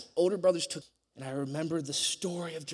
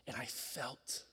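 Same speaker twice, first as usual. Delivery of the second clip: usual speaking voice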